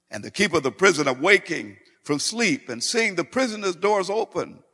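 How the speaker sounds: high, loud, mounting preacher's narration; full-force preaching. Real, not acted. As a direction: drive the story upward in pitch and volume. Preaches the jailer story.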